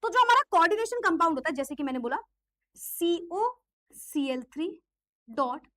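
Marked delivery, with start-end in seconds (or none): 2.7-5.7 slow, spaced dictation of the formula. Shown as a demonstration. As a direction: spell out each symbol slowly. Teacher dictating formula slowly.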